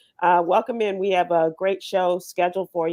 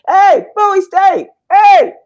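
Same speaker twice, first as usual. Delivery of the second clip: much higher, loud and slow hollered greeting; excited shout; 13 semitones higher, 13 dB louder, slower than usual. Retold or imitated. Brother calling to a Bowie State fan, loud high holler. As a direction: yell it down the block at a fan. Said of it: "my brother goes".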